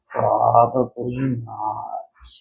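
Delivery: sighing. Acted. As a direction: sigh it out in hopeless gloom. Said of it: "sighed Frankenfrog".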